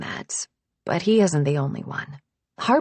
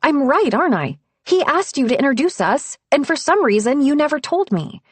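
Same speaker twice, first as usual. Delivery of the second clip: higher, louder, pressing her; angry accusation; 9 semitones higher, 6 dB louder than usual. A narrator's reading. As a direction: push her to admit it. Theo confronts Harper angrily.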